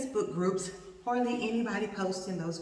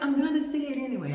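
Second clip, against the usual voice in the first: higher, louder, quick retort; lightly voiced doctor; 6 semitones higher, 5 dB louder, faster than usual. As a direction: quote the doctor, pitched up and fast.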